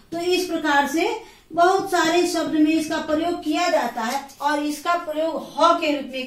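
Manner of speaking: ordinary delivery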